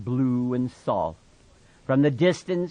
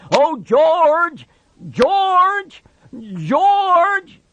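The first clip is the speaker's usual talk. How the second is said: much higher, much louder calls; crying out; 11 dB louder, much slower than usual. Acted. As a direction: shout for George in panic. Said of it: "and he cried".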